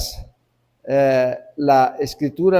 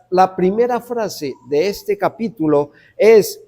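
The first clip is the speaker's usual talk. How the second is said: ordinary delivery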